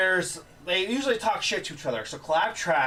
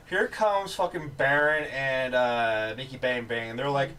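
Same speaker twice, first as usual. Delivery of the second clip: normal talking voice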